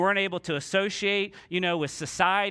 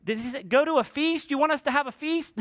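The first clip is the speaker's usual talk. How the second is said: high-pitched puzzled questions; baffled mishearing; 7 semitones higher than usual. Acted. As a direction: play someone straining to make out a shouted order. Lepers mishearing a yelled order.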